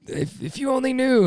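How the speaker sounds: very high, strained "if you only knew"; exasperated plea. Retold or imitated. Husband pleading he's misunderstood, exasperated.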